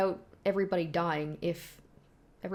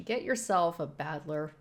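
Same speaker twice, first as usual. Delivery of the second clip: ordinary talk, nothing marked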